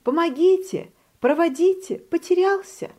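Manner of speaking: high frantic duckling calls; a lost baby duck yelling for help, pitched high; duckling crying out. A narrator's reading. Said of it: "и вдруг крик".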